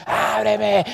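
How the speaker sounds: high, drawn-out mock yell; exaggerated high-pitched demand; demanding call. Shown as a demonstration. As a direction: call out forcefully, as the wrong way to woo. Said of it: "eso no es una voz".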